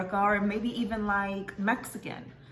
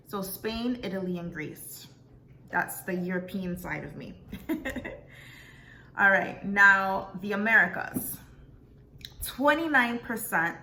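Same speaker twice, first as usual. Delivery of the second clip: usual speaking voice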